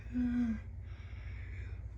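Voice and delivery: long tired yawning sigh. Real, not acted. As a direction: let out a slow yawn. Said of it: "I'm gonna yawn really quick".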